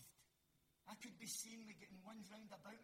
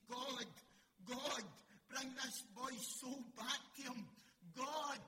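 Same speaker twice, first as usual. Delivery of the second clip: loud, high pleading cry to God; 4 semitones higher, 8 dB louder, slower than usual. Acted. Preacher acts Elijah crying out.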